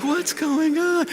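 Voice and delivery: high, soft, slow bafflement; bewildered and amazed. Retold or imitated. Apostles wandering away from the tomb, bewildered. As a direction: draw it out in hushed mock wonder. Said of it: "bewildered and amazed".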